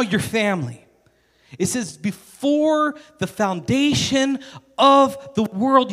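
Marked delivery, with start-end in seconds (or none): 2.0-5.9 BEFORE, FOUNDATION and OF stretched out, pitched high; slow, high, hammering each key word of "Before the foundation"; emphatic verse quoting. Real, not acted. Preacher stressing to congregation when they were loved, emphatic.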